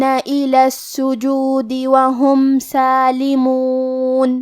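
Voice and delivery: slow, drawn-out melodic tilawat; measured Quranic recitation. Acted. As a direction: chant each verse slowly with full tajweed. Teacher recites the verses.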